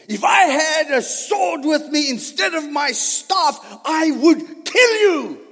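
Balaam threatening to kill the donkey; angry threat. Retold or imitated. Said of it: "he shouted".

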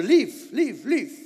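shouting a man off the doorstep; retold shout. Mother ordering the salesman out, loud and high.

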